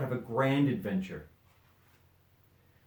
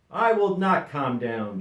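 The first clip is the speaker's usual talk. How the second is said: louder, slow stubborn shout; angry shout; 4 semitones higher, 7 dB louder than usual. A narrator's reading. Dodsworth refusing to calm down, slow loud shout. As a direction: refuse firmly. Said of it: "shouted Dodsworth".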